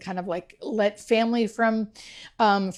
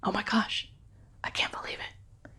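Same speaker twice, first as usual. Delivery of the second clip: awed whisper, words spaced out; retold hushed wonder, barely voiced; 9 dB quieter than usual. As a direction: whisper in joyful disbelief.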